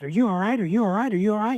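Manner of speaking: worried wife running out calling to him; wife rushing out the door, anxious and rising; anxious repeated question. Retold or imitated.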